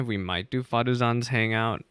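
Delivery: ordinary talk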